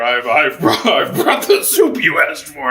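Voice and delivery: a lightly voiced son putting on his brother's voice; nervous try at sounding like someone else, half laughing; a hint of a disguised son bluffing his father; Jacob disguised. Jacob disguised, speaking to Isaac.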